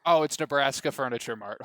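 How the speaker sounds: ordinary talk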